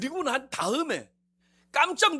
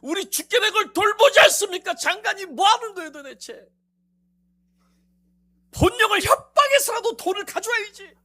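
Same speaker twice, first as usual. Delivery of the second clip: complaining; 9 semitones higher, 10 dB louder than usual. Retold or imitated. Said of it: "저에게 계속 하는 얘기".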